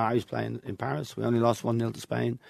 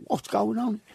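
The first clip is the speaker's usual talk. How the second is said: higher-pitched put-on Manchester accent; 11 semitones higher than usual. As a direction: fake English Manchester accent, high. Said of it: "in an English Manchester accent".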